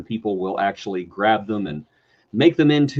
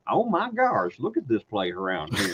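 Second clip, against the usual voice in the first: put-on Texas accent; 10 semitones higher than usual. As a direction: play the alien prince with a Texas twang. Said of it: "this alien prince has a Texas accent".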